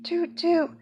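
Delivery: high, bright hoot like a horn; train horn toot. A narrator's reading. Pete honking the train horn, high imitated toot.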